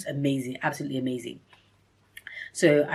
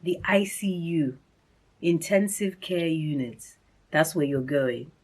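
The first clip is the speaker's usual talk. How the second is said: slow, deliberate retelling of bad news; grave, measured news; 4 dB quieter, slower than usual. Staff telling a patient she's going to intensive care, grave and measured. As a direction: spell it out slowly and seriously.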